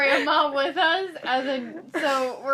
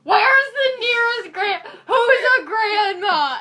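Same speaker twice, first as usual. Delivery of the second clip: high, loud, slow mock demand through laughter; mock frantic shout; 8 semitones higher, 9 dB louder, slower than usual. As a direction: yell it like a desperate fan, half laughing. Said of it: "you stand up and go".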